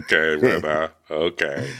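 low, deflated acted resignation; sighing resignation. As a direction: give in wearily after a big sigh. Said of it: "And Garcelle just sighs".

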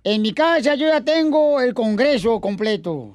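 lightly pompous campaign orator; mock politician rallying the crowd; booming balcony speech. Mock candidate speech continues. (discurso de candidato pomposo)

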